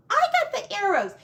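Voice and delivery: bickering piglet protest; high piglet voice. Acted. Toby arguing.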